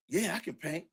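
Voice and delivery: a hint of a keen newcomer jumping at work; eager young rapper. Master P agreeing to paint, lightly voiced and eager.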